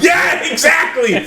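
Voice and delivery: high, shouted agreement; excited agreement. Real, not acted. Co-host backs up the joke, excited shout. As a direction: jump on it with a high burst.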